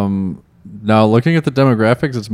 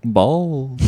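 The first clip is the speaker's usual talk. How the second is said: put-on [name] accent; 4 dB quieter than usual. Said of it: "I'm doing [name]".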